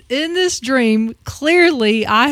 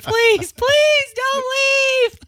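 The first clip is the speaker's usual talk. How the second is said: hammed-up tearful plea, much higher; mock pleading; 14 semitones higher than usual. Retelling the dream, begging Dave not to leave, mock crying. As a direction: beg like you're about to cry, overdone. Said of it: "And I'm like".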